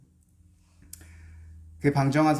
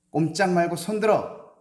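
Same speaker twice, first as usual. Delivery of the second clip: sudden loud, higher barked command; robber's shout; 7 semitones higher, 6 dB louder than usual. Acted. Masked robber barking at the reading scholar, loud command. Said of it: "이렇게 말했습니다".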